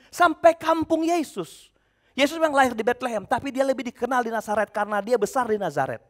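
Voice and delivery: ordinary delivery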